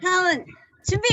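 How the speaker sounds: high, loud cue to the group; loud rallying call. Real, not acted. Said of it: "큰 소리로 외쳐보도록 하겠습니다".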